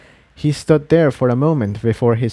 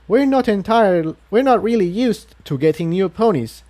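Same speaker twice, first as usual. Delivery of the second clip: higher, eager welcoming voice; chatty guide Quill Dipper; 7 semitones higher than usual. A narrator's reading. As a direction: greet the newcomers like a keen guide. Quill Dipper greets the newcomers.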